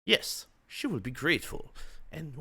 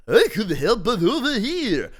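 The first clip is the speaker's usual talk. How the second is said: suave stranger voice; 9 semitones higher, 10 dB louder than usual. Acted. Bruce voiced.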